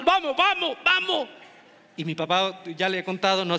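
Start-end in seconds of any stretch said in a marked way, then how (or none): 0.0-1.3 higher, pressing mock dad's call; father hauling his son away from the dance; insistent father. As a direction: hurry the boy out like an impatient dad. Father calling son to leave.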